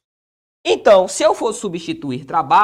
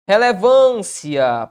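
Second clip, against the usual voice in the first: "Relevância" slow and loud, held on "vân"; stretched tonic syllable; 2 semitones higher, 6 dB louder, much slower than usual. Shown as a demonstration. Teacher sounding out "Relevância" for its stress rule, drawn-out. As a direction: lean long on "vân" in "Relevância". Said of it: "Paroxítona".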